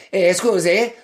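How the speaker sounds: a hammed-up Italian "Eh, scusi" to clear the bar; northern Italian accent. Shown as a demonstration. Ordering at a busy bar in mock Italian accent. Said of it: "order your drinks in a northern Italian accent".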